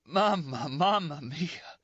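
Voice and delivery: high, slow choked plea; choking weak cry for mother. Acted. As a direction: force the words through choking. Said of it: "Then choking".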